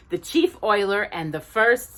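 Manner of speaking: ordinary delivery, nothing marked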